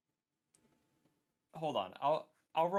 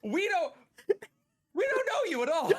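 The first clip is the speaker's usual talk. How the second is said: exaggerated denial; 13 semitones higher, 5 dB louder than usual. Character denies knowing the bard.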